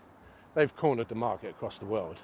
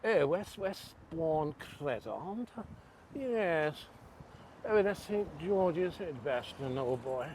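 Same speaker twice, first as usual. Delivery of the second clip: higher-pitched, exaggerated posh English accent; mock posh accent; 6 semitones higher, slower than usual. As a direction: put on a hammed-up posh English voice. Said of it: "Have to change my accent now".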